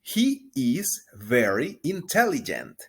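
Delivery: slow, clear teacher model; drawn-out model line; slow model sentence. Shown as a demonstration. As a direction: say it slowly to be repeated. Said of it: "repita después de mí".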